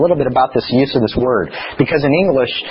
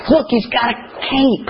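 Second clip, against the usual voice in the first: put-on child's voice; 10 semitones higher than usual. Father retelling his son's words in a higher kid voice. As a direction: kid voice pointing out the cape. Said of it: "And he goes".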